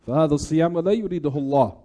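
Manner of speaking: ordinary delivery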